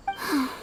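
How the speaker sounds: a quiet, contented sigh; soft sigh. Acted. Ayaka after the traveler's kind words, soft sigh. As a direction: let out a gentle sigh.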